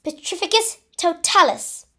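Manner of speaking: high, loud incantation; yelled spell. A narrator's reading. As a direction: cast the spell with a forceful yell. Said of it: "Hermione yelled".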